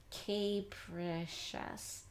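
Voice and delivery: "Capricious" very slowly as "cape-rih-shus"; long A. Shown as a demonstration. Teacher trying a long A, slow and segmented. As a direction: stretch "Capricious" in chunks, first vowel as in cape. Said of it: "We could try A".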